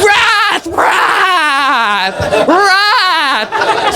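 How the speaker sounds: high, drawn-out comic roar; mock wrath. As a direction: savour the word as a hammed-up cry. Hamming up the word wrath.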